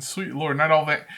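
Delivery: normal talking voice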